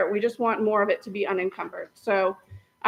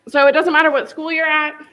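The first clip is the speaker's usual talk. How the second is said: raised voice; 6 semitones higher, 6 dB louder than usual. Real, not acted. Speaking over a failing mic.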